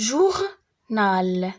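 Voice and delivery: "journal" slowly as jour, pause, nal; teacher dividing "journal" into jour and nal; split into syllables. Shown as a demonstration. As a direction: break "journal" into two drawn-out syllables. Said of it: "غادي نقسموهم على لي سيلاب".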